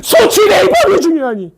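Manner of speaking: very high, strained yelling question; mock outraged shout. Teacher challenging class on which method matters, shouting. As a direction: shriek it as an exaggerated challenge.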